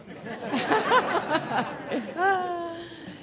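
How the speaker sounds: long, loud, high laugh; big rolling laugh; hearty laugh. Real, not acted. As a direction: burst out laughing, drawn out. Laughs after own blunt answer.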